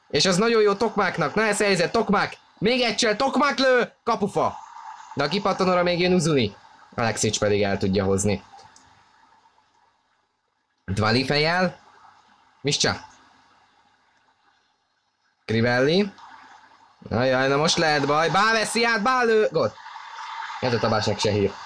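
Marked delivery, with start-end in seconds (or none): 0.1-6.6 high, tense build to the shot; calling it high as the chance grows; excited hungarian sports commentary. Real, not acted. Shot hits the post. 17.1-19.7 building high up to the goal; high, urgent call of the goal; excited hungarian sports commentary. Real, not acted. Opponent scores a goal.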